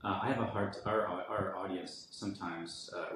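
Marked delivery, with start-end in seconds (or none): none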